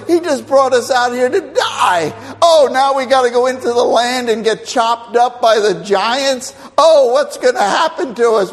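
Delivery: loud, high whining crowd; mock complaining shout. Preacher voicing the grumbling Israelites, loud mock complaint. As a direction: wail it like a complaining mob.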